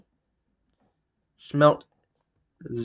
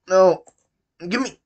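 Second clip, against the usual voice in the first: ordinary talk, nothing marked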